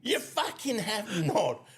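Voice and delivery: high, drawn-out disbelieving protest; mock indignation. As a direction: protest hotly while laughing. Mock quarrel over joke count.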